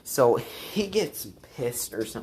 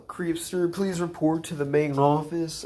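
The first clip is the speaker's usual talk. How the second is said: higher, imitating a school intercom call; intercom announcement; 5 semitones higher than usual. School office summoning him over the intercom, announcer voice. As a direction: read it like the office loudspeaker.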